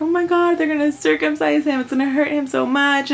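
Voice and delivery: picture the pain and let worry rise; retold panic. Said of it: "I felt all these emotional things".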